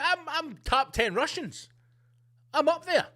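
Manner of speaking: smug inner voice. Retold or imitated. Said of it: "You must think".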